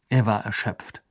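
usual speaking voice

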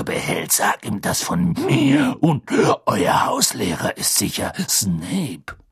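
put-on drawn-out, growling old man's warning; gruff Moody, menacing. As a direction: drag out the threat, lunging on key words.

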